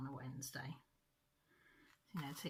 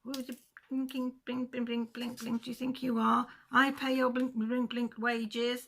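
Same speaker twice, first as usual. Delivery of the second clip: mock furious yell, pitched higher and louder; imitating an angry shout; 7 semitones higher, 6 dB louder than usual. Neighbour yelling at the dustbin men, mock shouted. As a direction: ham up the neighbour's tantrum at the bin men. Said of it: "he was shouting up at these dustbin bloke".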